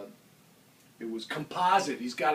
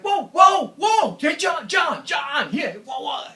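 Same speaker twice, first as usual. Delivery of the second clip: high, loud panicked whoa shouts; alarmed shout; 13 semitones higher, 13 dB louder, slower than usual. Retold or imitated. Jerry slipping on the rocks, shouting to John in alarm. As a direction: yell for help as you start to slip. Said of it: "all of a sudden he's like".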